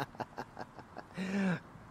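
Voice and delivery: soft, high staccato chuckle; flirtatious chuckle. Acted. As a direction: chuckle softly, teasing the lover. Flirting after a playful threat.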